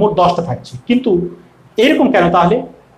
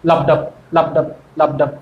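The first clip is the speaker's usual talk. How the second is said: লাবডাব in low, even two-beat pulses; low, measured লাবডাব, beat by beat; heartbeat rhythm; 6 semitones lower than usual. Shown as a demonstration. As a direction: chant লাবডাব like a heartbeat, pausing after each. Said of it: "স্পন্দনের মতো শব্দ".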